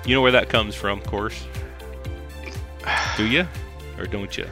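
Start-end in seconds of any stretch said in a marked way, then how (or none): none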